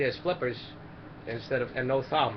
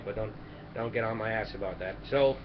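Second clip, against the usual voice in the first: usual speaking voice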